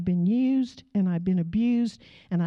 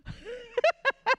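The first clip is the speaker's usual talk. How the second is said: high startled laugh. Real, not acted. Reacting to the snarl.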